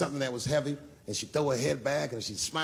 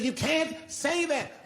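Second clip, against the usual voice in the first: high, drawn-out mock wail; crying heckler; 14 semitones higher, much slower than usual. Upset audience woman objects to the comic, high mock cry. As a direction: imitate an upset woman, pitched way up. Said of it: "she was crying".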